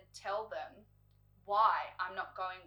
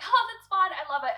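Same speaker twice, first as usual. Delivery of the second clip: high and loud, falsely delighted; fake cheerful approval; 10 semitones higher, 12 dB louder than usual. To the hairdresser, faking she loves the cut, high and loud. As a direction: gush a lie brightly, much higher. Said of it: "I'm like".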